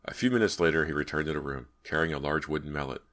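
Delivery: usual speaking voice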